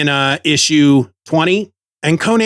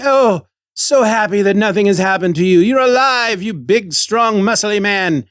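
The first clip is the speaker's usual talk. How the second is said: much higher, gushing queen voice; swooning queen; 6 semitones higher than usual. Retold or imitated. Podcaster mocking the queen greeting Conan, gushing. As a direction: gush over him like a smitten queen. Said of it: "she's like".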